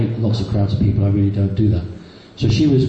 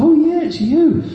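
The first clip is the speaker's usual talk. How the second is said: very high, drawn-out mock surprise; high-pitched retold realisation; surprised recognition; slower than usual. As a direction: imitate her delighted double-take, slow and high. Retold shop woman recognising his photo.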